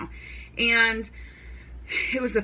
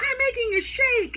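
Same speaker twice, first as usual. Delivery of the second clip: squeaky high, silly on-camera voice; high goofy hosting voice; goofy video voice. Retold or imitated. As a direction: ham it up like a fool for the camera. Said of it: "I'm like a fool".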